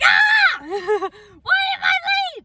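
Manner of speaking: high, loud panicked yell; panicked shout. Retold or imitated. Thinking her period is late, panicking with a loud shout. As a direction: shriek it, sure she's a week late. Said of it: "and I was like".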